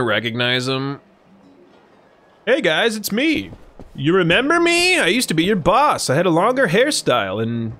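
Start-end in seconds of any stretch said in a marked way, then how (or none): 2.4-7.7 high, hammy show-off voice; boastful Majima. Acted. Majima to his old staff, playfully bragging about his abs. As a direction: strut and flex, mock-proud.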